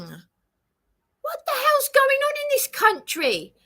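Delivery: high, slow, tearful cry; wailing about the state of the country; crying outcry. Real, not acted. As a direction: break into a sob of despair. Distressed outcry about police.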